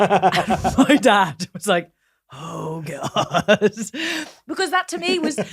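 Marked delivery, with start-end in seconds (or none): 0.0-4.5 laughing. Real, not acted. Dad reacts, speaker laughing.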